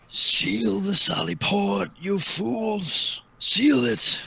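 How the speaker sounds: high, drawn-out urgent scream; screaming dwarf. Acted. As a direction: scream orders down from a tower.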